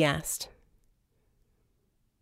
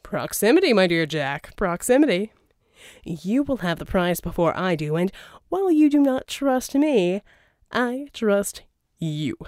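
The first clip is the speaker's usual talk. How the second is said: louder, smooth persuasive schemer; a charming villain's pitch, louder; Verdigris voiced; 3 dB louder than usual. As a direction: sell the deal, oily and grand. Verdigris reassuring Jack.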